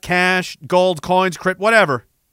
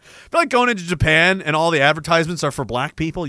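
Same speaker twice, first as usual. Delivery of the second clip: ordinary talk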